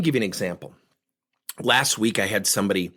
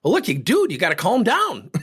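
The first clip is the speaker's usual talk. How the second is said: hyper coach; 8 semitones higher, 4 dB louder than usual. Retold or imitated. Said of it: "I might run the risk of saying".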